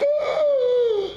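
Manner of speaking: squeaky, laughing gasp; a hammed-up breathless gasp; comic choked gasp of shock; high gasping ooh. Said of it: "I gasped".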